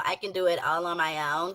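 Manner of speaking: mimicking her voice. Streamer mimicking how the woman in the video talks, low and soft. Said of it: "This is her voice".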